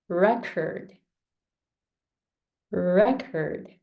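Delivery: "record" repeated for practice, REC strong each time; stress on REC. Shown as a demonstration. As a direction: drill "record" with first-syllable stress. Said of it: "re is stronger than".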